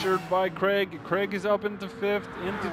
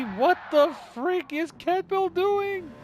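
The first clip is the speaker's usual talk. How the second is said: high, slow disbelieving question; can't believe what he's seeing; baffled commentator calling out a driver; incredulous; 11 semitones higher, 3 dB louder, slower than usual. Real, not acted.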